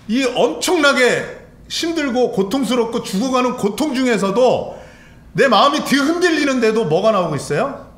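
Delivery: loud, high, impassioned sermon delivery; urgent pulpit cry; fervent preaching. Acted. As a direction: preach the agony at full force. Preacher at full force.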